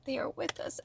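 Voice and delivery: breathy hushed whisper; whispered reassurance. Acted. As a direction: lean in and whisper it like a secret. Comforting viewers about spirits.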